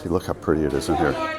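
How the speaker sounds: ordinary delivery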